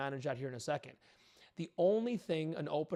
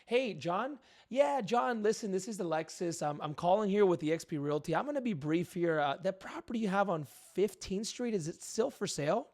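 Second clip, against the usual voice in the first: high, upward-lilting sales pitch; cheesy salesy tone; 4 semitones higher, 4 dB louder than usual. Shown as a demonstration. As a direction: sound like a pushy cold caller. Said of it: "that upward inflection, salesy tone".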